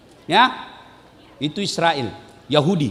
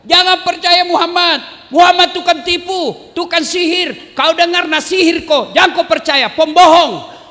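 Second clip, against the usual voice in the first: high, loud street-preacher yelling; blind man shouting; 8 semitones higher, 3 dB louder than usual. Acted. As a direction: rail at the market crowd, voice pitched up. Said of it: "dia masih teriak-teriak".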